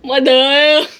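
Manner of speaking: loud call to mother. Real, not acted. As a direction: yell for your mom from the next room. Calling out off scene.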